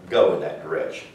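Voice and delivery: ordinary talk, nothing marked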